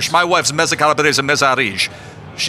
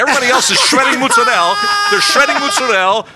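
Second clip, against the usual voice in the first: excited; 8 semitones higher, 4 dB louder than usual. Real, not acted. Guest boasting about Schenectady family.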